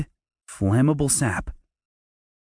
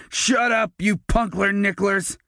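much higher furious snap; bully ordering after falling in a bush; 9 semitones higher than usual. Acted. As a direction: bark the order through humiliation. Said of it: "Derek ordered".

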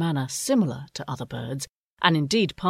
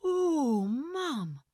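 put-on high, soft young owl voice; delighted owlet; 12 semitones higher, 8 dB quieter, slower than usual. A narrator's reading. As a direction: coo over the new name. Soren delighted by the name.

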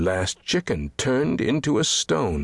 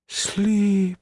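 high, drawn-out, plaintive sigh; mournful sigh; 13 semitones higher than usual. A narrator's reading. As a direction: sigh the word out long and sorrowfully. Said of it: "he sighed".

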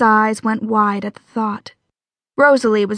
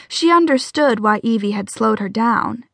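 ordinary delivery

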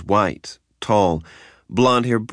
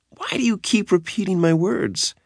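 much higher, annoyed female voice; high exasperated voice; 13 semitones higher than usual. Acted. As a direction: play her put out and squeaky. Nikki exasperated at his echoing.

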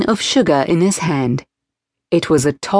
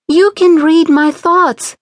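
put-on startled girl, higher and louder; alarmed; 10 semitones higher, 4 dB louder than usual. A narrator's reading. Girl alarmed at the stranger, a little scared. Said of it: "alarmed and a little scared".

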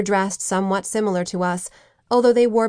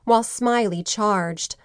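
usual speaking voice

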